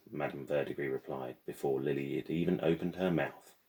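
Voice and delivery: usual speaking voice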